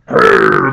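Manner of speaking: low, loud rolling growl; bear growl. Pretend. Lecturer springing an imagined bear on the class, mock growl. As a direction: imitate a bear's growl. Imitates a bear appearing suddenly.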